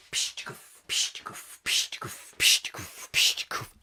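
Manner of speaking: unvoiced puffing chuffs; soft whispered engine sounds; steam engine noises. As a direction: puff like a little steam train. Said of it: "Ivor the engine?".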